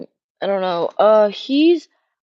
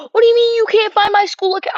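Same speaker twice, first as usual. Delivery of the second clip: gasp, then a high, loud, indignant question; high, loud, startled complaint; shocked outburst; 14 semitones higher, 9 dB louder, faster than usual. Real, not acted. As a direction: react with disbelief at the screen. Login fails, gasps.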